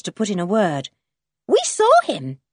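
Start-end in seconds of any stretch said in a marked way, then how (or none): 1.5-2.4 put-on high, loud child's voice; high-pitched little blurt; young Jan. A narrator's reading. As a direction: pipe it up high, insisting.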